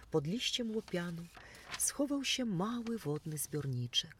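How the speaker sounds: ordinary delivery, nothing marked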